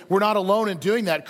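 ordinary talk, nothing marked

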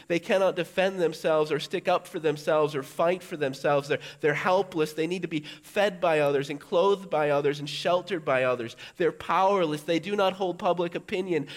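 high, loud, insistent rebuke; Jesus rebuking disciples. Retold or imitated. Jesus urging his disciples to look at the children, loud and high. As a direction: make dull men see the children. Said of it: "as if he is saying to them".

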